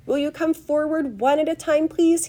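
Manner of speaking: high, loud fluting host; high-pitched showman's summons; fluty calling out. A narrator's reading. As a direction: call the group forward in a high, fluty voice. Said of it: "he called out".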